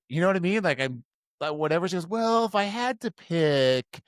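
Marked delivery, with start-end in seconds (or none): 2.1-4.0 slow, high girlish imitation; imitated coy date. Date giving in and choosing merch, imitated coy voice. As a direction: draw it out high, as if reluctantly choosing.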